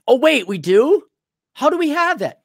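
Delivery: mock surprise. Sarcastic mock surprise about drugs.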